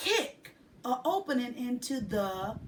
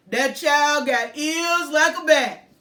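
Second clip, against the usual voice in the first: louder, rolling man's voice; rumbling musical voice; 3 semitones higher, 11 dB louder than usual. A narrator's reading. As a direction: rumble it out like a friendly old man. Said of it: "in a rumbling musical voice".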